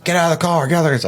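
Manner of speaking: high, urgent repeated command; mock police yell. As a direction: imitate cops ordering a driver out. Retold police at gunpoint.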